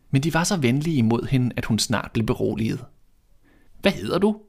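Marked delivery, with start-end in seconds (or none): 3.8-4.4 higher little dwarf voice; dwarfs asking. A narrator's reading. Dwarfs asking the girl her name, higher voice. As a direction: ask it curiously, pitched up. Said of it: "spurgte de".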